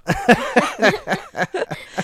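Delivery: high, sustained laughing fit; amused, high-pitched laughter. Real, not acted. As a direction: crack up at your own story. Laughing at own anecdote.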